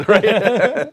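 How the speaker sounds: laughing it out, high and loud; a big laugh on the word. Real, not acted. Laughing at own little fortune.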